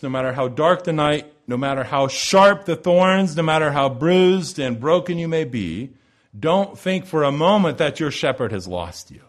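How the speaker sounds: impassioned preaching. Acted. Preacher urging the congregation not to despair, impassioned preaching. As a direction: drive each no matter how home harder. Builds to full-force preaching.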